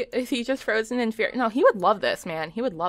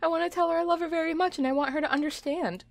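high, cooing sympathy; sappy high-pitched tenderness; tender gushing; 8 semitones higher than usual. Real, not acted. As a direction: coo with aching affection, voice pitched up. Gushes about toddler's sad little heart.

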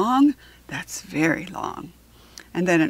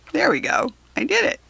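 proud; 9 semitones higher, faster than usual. Real, not acted. Pleased after pronouncing anemone.

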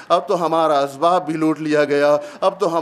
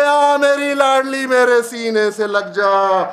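tender lament; 6 semitones higher than usual. Acted. Father embraces daughter, recited.

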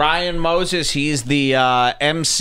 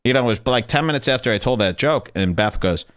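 ordinary talk

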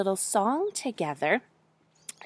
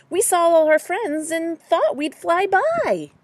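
high, louder bird voices; cheery birds; 7 semitones higher, 5 dB louder than usual. A narrator's reading. Birds explain to their friends, high and loud. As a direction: pitch it up and call it out. Raven and wren answer the bear.

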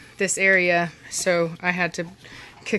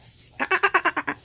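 normal talking voice

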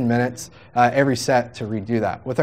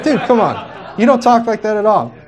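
higher and louder, laughing through it; laughing protest; 7 semitones higher, 4 dB louder than usual. Retold or imitated. Teasing Matt about his creepy voice, laughing disbelief. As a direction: tease your friend between laughs. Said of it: "It's like".

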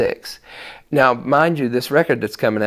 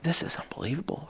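awed whisper; 6 semitones higher, 14 dB quieter than usual. Retold or imitated. Retold inner amazement at songs.

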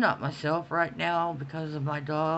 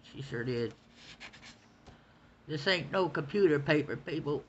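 ordinary talk, nothing marked

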